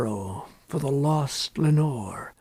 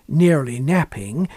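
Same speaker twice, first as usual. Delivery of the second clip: ordinary delivery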